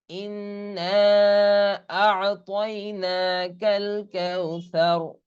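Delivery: slow melodic tajweed chanting; Quran recitation. Shown as a demonstration. Teacher reciting the surah for students, slow chant. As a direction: draw the vowels out in recitation.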